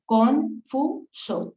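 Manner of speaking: confuso drawn out slowly, syllable by syllable; slow syllable demo. Shown as a demonstration. Teacher counting syllables for the class, slow and deliberate. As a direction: stretch confuso into its three syllables. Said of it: "tiene tres sílabas".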